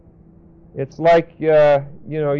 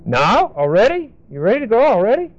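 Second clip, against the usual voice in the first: rising, incredulous, higher "already"; a parent amazed the child is packed already; surprised parent; 6 semitones higher, faster than usual. Retold or imitated. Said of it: "And you'll say".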